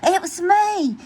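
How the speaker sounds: high cheeky admission; owning up. Acted. Grumpy Toad admits to Pete, cheeky. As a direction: confess with a cheeky grin. Gus owns up.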